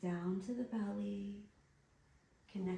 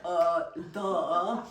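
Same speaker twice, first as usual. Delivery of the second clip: dumb guy; 3 semitones lower, 10 dB louder than usual. Retold or imitated. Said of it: "they're just like".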